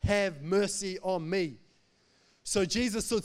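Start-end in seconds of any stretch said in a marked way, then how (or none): 0.0-1.6 loud, slow, drawn-out plea; yelling louder. Acted. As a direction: push each word out as an acted desperate call. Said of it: "yelled a little bit louder".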